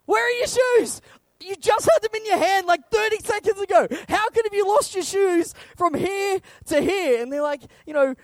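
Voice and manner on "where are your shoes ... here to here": high, louder disbelief; can't believe they lost them again; exasperated dad. Retold or imitated. Dad exasperated at kids losing shoes, high and louder.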